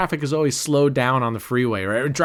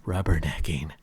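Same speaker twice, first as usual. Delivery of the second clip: low, mock-sultry imitation; lightly teasing sultry echo; imitating sexy delivery; 8 semitones lower, 4 dB quieter than usual. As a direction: purr it low, as she supposedly did. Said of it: "her saying".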